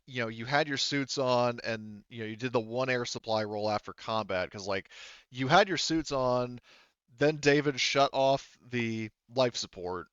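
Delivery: ordinary delivery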